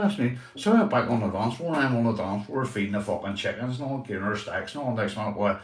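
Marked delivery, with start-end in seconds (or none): none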